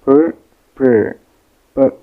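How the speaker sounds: "per" repeated alone, loud and separate; "per", a single syllable drilled for learners; isolated syllable demo. Shown as a demonstration. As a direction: sound out "per" firmly with gaps. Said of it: "it is pronounced per".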